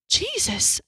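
a high, horrified gasp of a word; horrified under the breath. A narrator's reading. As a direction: breathe it out in shock. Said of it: "I thought to myself, horrified".

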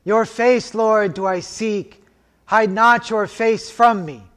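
normal talking voice

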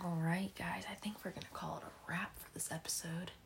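whispered quick wrap-up. Real, not acted. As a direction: whisper the sign-off fast. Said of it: "whispered sign-off".

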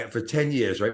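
normal talking voice

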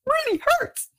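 high, pained outburst while being waxed; cursing in pain. Retold or imitated. Woman in pain at the waxing salon cursing at the pain. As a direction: yelp it out as the wax rips. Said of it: "And I cursed".

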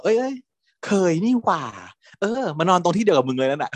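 high, bright sudden realisation; high surprised blurt; sudden recollection. Retold or imitated. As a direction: blurt it out as it comes back to him. Friend suddenly remembers.

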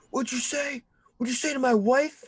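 high, loud confrontational demand; angry challenge. Retold or imitated. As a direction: get in his face about your wife. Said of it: "This guy is standing up and he's like".

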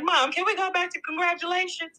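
put-on high-pitched eager kid request; imitated excited child. Mother imitating her excited child after graduation, high eager child voice. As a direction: pitch it way up like your child asking. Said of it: "she was just so excited".

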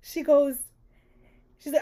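normal talking voice

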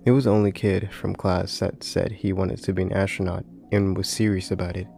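ordinary delivery, nothing marked

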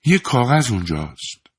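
lightly voiced mocking young woman; strange odd tone. A narrator's reading. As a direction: say it with a sly strangeness. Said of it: "با لحنی خاص و غریب گفت".

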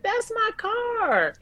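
high, slow stunned cry; drawn-out disbelief at the wreck; shocked realization. Retold or imitated. Realizing car was wrecked.

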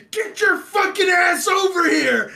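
high, loud, slow furious mock yell; angry mom yell. Mother finds her son after hours, furious shouted scolding.

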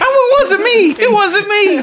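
put-on high, giggly little-kid denial; guilty kid voice. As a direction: squeak out a guilty kid's excuse. Playing caught kid denying.

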